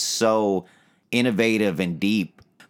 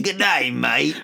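put-on playful Australian accent, pitched higher; jokey Crocodile Dundee Australian accent; 8 semitones higher, slower than usual. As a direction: greet like a hammed-up Australian. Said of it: "Crocodile Dundee".

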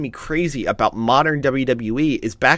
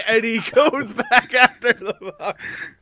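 slow, high, through laughter; helpless amusement stretching the words; laughing; 11 semitones higher, 5 dB louder, slower than usual. Real, not acted. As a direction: crack up while talking. Cracks up at the retold yell.

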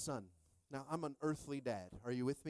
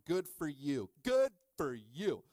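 loud teasing praise, growing slower and bigger; mock congratulation; 9 dB louder, slower than usual. Preacher teasing fit dads about celery, mock congratulation. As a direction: draw out the sarcastic praise on the repeat.

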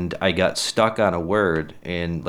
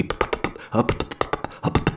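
demo stutter on P; 3 semitones higher, much faster than usual. Shown as a demonstration. Showing how P tripped him up, stuttering. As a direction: get stuck popping on the P. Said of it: "If I was trying to say anything with a P".

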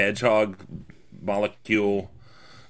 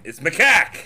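put-on Boston accent; 2 semitones higher than usual. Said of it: "he had a Boston accent".

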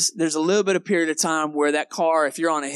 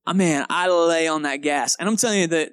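ordinary delivery

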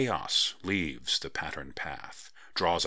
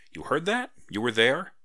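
sudden much higher, louder disbelief; surprised; 7 semitones higher, 6 dB louder than usual. Acted. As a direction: caught off guard, pitch jumps up. Kendra startled she was overheard.

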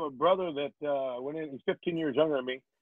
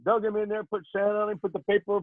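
normal talking voice

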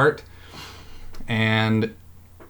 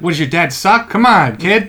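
put-on high, louder hawker taunting a kid; cheeky carnival barker voice; pushy vendor callout; 8 semitones higher, 6 dB louder than usual. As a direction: goad the kid like a street seller. Joins vendor impression, calling out.

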